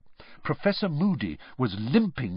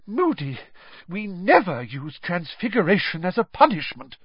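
high-pitched, weakened reproach; weak protest; 7 semitones higher than usual. A narrator's reading. McGonagall reproves Moody for transfiguring a student, weakly. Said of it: "said Professor McGonagall weakly".

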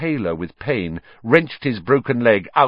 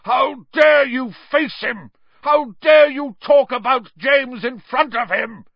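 high, loud, furious roar; enraged full-voiced shouting; 7 dB louder than usual. A narrator's reading. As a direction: bellow in outrage.

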